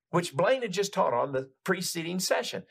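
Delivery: ordinary delivery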